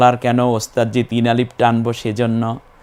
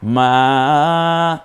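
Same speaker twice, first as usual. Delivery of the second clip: মা chanted on a long held vowel; one-alif stretch; 3 semitones higher, 4 dB louder than usual. Shown as a demonstration. Teacher shows the alif stretch, মা held long. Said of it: "মা এক আলিফ টেনে বলবো".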